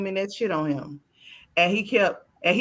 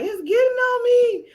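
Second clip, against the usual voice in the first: put-on higher whining little boy; whiny son; 4 dB louder than usual. Son in the bathroom whining about the mess, higher. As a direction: whine it like a grossed-out kid. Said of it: "he was in there like".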